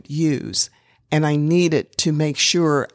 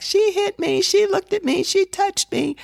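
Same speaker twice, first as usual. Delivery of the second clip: put-on high, whining children's voices; tattling children, much higher; whiny kids; 12 semitones higher than usual. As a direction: mimic squabbling kids, pitched way up. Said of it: "all four of them may have been saying something like".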